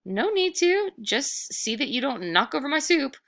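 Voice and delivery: put-on a small boy calmly standing his ground; brave boy.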